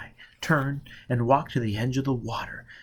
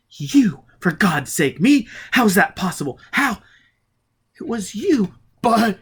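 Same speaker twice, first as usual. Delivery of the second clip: higher and louder, shaken; can't believe his eyes; stunned disbelief; 9 semitones higher, 6 dB louder than usual. Acted. Foyle questioning Robin in shocked disbelief.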